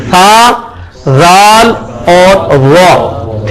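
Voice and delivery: "سا", "زال", "وا" slowly as "tha, dhaal, dhaw"; tongue-on-teeth letters. Shown as a demonstration. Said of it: "یہ تینوں حرف ایک مخرج سے نکل رہے ہیں". ("سا"، "زال"، "وا" ایک مخرج سے، کھینچ کر)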